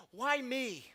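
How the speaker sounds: a high, pained cry to his father; burned boy's plea. Retold or imitated. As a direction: plead like a hurt child asking why. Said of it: "he's looking up at his father, and he says".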